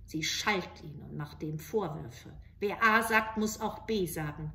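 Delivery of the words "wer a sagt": plain reading voice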